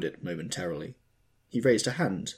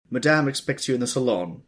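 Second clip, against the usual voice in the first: raised high announcing call; cried out; 6 semitones higher, 8 dB louder than usual. A narrator's reading. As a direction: call it out, needlessly loud. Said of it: "he cried".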